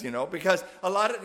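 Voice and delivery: normal talking voice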